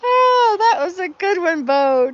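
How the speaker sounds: high, loud, helpless amusement; real laughing delight. Real, not acted. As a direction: cry out, weak with laughter. Recovering from big laugh at joke.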